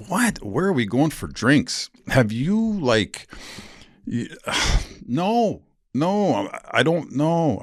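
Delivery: high, slow, stunned disbelief at a flirty text; incredulous. Retold or imitated. Retelling his reaction to a woman's text, incredulous and high. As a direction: ask it like you can't believe your eyes.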